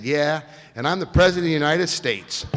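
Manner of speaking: loud, mocking disbelief; scoffing retort to a prank caller; sarcastic. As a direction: brush off a prank call with scorn. Sarcastic reply to supposed prank.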